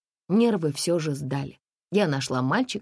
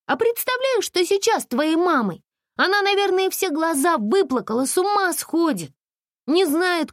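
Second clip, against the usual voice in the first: put-on higher, gently persuasive girl voice; Masha coaxing a boy; 4 dB louder than usual. Girl coaxing lost boy to go back to his worried mother.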